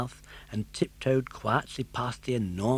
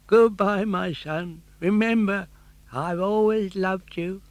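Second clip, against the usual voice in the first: a father's tender farewell, raised pitch; tender old father; 7 semitones higher, 5 dB louder than usual. Acted. Geppetto bidding his son farewell, tender. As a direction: say goodbye to your son lovingly.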